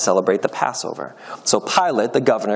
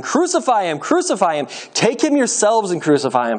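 higher-pitched crowd cry, restrained volume; shouting; 8 semitones higher than usual. A narrator's reading. Priests and guards demand execution, restrained shouting. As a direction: chant the demand like a mob, held back. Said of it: "began shouting".

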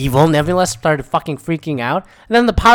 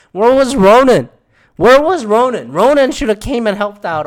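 loud, high, insistent questioning; exasperated demand; 7 semitones higher, 7 dB louder than usual. Real, not acted. Fan ranting about the missing villain, exasperated loud demand. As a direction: raise the voice, demanding an answer.

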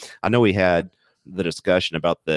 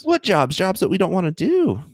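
exasperated retort; 14 semitones higher than usual. Real, not acted. Arguing back.

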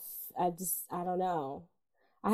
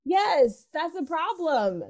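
emphatic; 8 semitones higher, 4 dB louder, much slower than usual. Real, not acted. Emphatic repeat.

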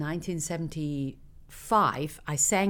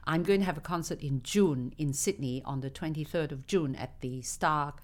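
normal talking voice

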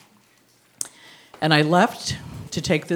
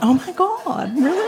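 delighted surprise; 10 semitones higher than usual. Retold or imitated. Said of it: "And I went".

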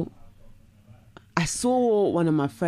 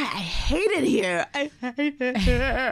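moaning; 8 semitones higher, much slower than usual. Retold or imitated. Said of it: "I was just like".

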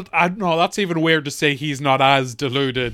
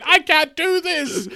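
crying bride; 10 semitones higher than usual. Retold or imitated. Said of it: "runs out of the room crying".

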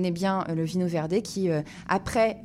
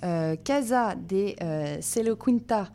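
slow, careful attempt at a Portuguese accent on the wine name; self-conscious Portuguese accent on the wine label; slower than usual. Shown as a demonstration. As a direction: sound out the Portuguese name haltingly. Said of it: "horrible mon accent".

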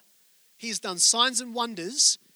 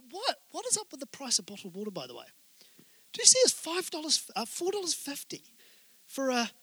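incredulous aside; 5 semitones higher, 5 dB quieter than usual. Real, not acted. Aside about bottled water price.

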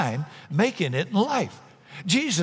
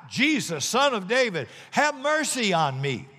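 higher calling out for mercy; pleading call; 7 semitones higher than usual. Acted. Blind beggar calling to Jesus over the crowd, pleading. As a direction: call out to him across the crowd. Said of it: "he began to shout".

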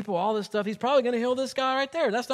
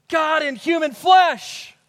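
slow, loud proclamation; preaching shout; 4 semitones higher, 6 dB louder, slower than usual. Real, not acted. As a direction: shout it out as the sermon's climax. Preacher climaxes.

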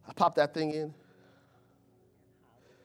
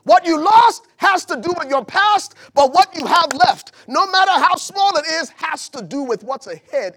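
high, loud preaching; raised, urgent sermon voice; full-force preaching; 10 semitones higher, 11 dB louder than usual. Acted. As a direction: shout it to the back row. Preaching peak.

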